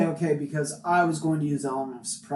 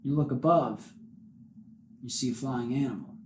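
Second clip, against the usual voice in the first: normal talking voice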